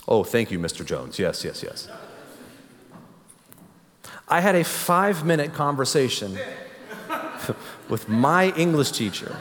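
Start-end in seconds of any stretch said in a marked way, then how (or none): 0.1-1.9 much lower, faking a grown man; teen faking his dad's deep voice on the phone. Retold or imitated. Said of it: "I lowered my voice". 6.9-7.8 breathless laugh. Real, not acted. Laughing at own prank story.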